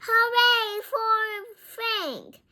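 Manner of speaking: high, loud hooray; yelled, high-pitched cheering. A narrator's reading. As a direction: cheer someone on at the top of your voice. Bean yells a cheer.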